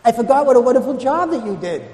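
high, fumbling sheepish excuse; flustered customer. Retold or imitated. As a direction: stammer out a lame excuse. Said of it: "And then you say to me".

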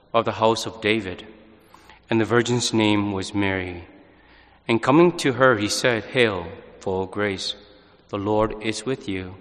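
measured, solemn scripture reading; gospel reading. Acted. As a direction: proclaim the Gospel calmly and steadily. Priest proclaims the Gospel reading.

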